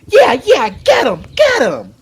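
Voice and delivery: high, loud gleeful shouting; excited cheering. Acted. Boy cheering the fight from Dunk's shoulders, excited shouting. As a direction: bounce with excitement as you yell.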